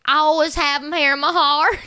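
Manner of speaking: mock Southern accent. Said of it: "That was Southern".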